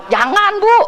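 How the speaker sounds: high-pitched exclaimed warning, acted out; retold alarmed veto; urgent warning. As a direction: jump in high to stop her. Acts out stopping a confrontation.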